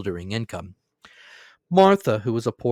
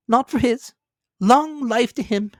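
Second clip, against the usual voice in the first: grudging wife; 14 semitones higher, 4 dB louder than usual. A narrator's reading. Wife toasting Scrooge for her husband's sake, high bitter voice. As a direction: bite out the toast reluctantly. Mrs Cratchit's voice.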